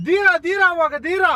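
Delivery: high, punchy heroic declaiming; film dialogue. Shown as a demonstration. Kidnapper prompting his captive with a line, as film dialogue. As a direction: throw it out like a hero's catchphrase. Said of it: "ಒಂದ್ dialogue ಹೊಡೀರಿ".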